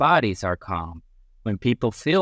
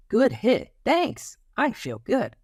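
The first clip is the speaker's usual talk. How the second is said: put-on much higher, bright playground kid; high kid's voice; 11 semitones higher than usual. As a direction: speak as a happy child at play.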